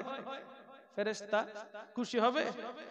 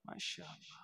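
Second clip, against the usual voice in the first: ordinary delivery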